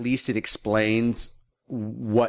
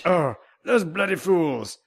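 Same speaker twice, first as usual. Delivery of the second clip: higher, drawn-out British exclamation; hammed-up British lament, slow and high; mock British accent; 7 semitones higher, slower than usual. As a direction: put on an exaggerated British accent. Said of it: "Very, very British".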